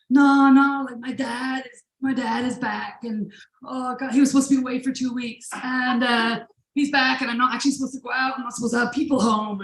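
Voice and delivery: mildly acted fluster, speeding up; freaking out. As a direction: imitate a panicking friend, words tumbling out. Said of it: "Ryan just starts freaking out".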